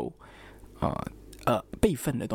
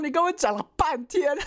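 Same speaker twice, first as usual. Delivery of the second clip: high exasperated complaint; you wasted my time, say it pitched way up; much higher exasperated you-talked-forever; 13 semitones higher than usual. Retold or imitated. Librarian says library closed.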